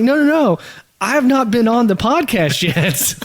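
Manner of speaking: high-pitched mock insistence; retold protest. Guest correcting his co-host about the podcast, emphatic protest. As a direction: deny it emphatically, retelling.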